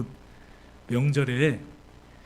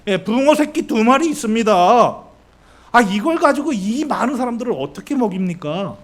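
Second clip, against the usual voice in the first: loud, high-pitched exasperated protest; hammed-up indignant grumbling; slightly angry disciples; 5 semitones higher, 6 dB louder than usual. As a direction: complain up to the master in acted frustration. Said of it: "지금 약간 화가 난 거예요".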